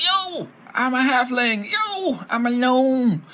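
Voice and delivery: high and slow, bluffing; silly goblin voice. Acted. Disguised goblin lying to strangers, slow high silly voice. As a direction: sell the disguise with a grin. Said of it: "unable to do the silly voice".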